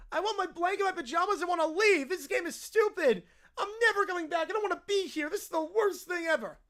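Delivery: put-on tearful bratty child tantrum; mock-crying petulant little kid; whiny kid. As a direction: wail like a kid demanding to leave. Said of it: "peak whiny kid territory".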